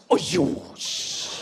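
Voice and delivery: imitating a disgusted hiss of dismissal; mock disgust, trailing into a voiceless shush. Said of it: "we're just disgusted".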